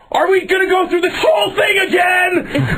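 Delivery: very high-pitched screamed complaint; acted outraged shriek; screaming. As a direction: scream it in exasperated disbelief. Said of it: "I start screaming".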